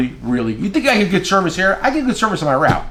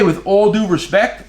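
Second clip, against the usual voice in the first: slow demo phrase; 4 semitones higher, slower than usual. Shown as a demonstration. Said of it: "If you say".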